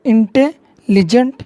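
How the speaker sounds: Intelligent drawn out very slowly, syllable by syllable; slow teacher demo of intelligent, syllables pulled apart. Shown as a demonstration. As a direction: stretch out intelligent so each syllable can be counted. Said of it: "তাই intelligent".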